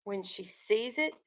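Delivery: steady read-aloud storytelling voice; story narration. A narrator's reading. Teacher reading a picture book to her class, storytelling voice.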